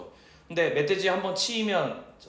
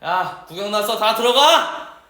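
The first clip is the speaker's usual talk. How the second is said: barked order; 4 semitones higher, 9 dB louder, slower than usual. Retold or imitated. Sergeant ordering onlookers away.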